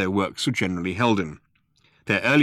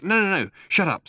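Ford; 10 semitones higher than usual. A narrator's reading. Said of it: "Ford hushing Arthur".

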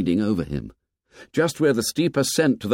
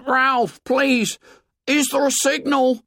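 high, desperate begging; pleading Piggy. A narrator's reading. Piggy begging Ralph to say whether the signal burns. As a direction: beg him for an answer.

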